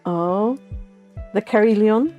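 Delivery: ordinary delivery, nothing marked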